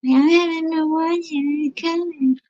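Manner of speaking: high, slow whiny whine. Real, not acted. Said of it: "I just sound like a little whiny baby".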